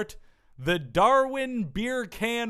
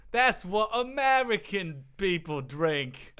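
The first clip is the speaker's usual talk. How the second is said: ordinary talk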